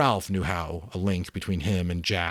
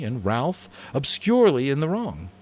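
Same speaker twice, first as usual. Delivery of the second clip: ordinary delivery, nothing marked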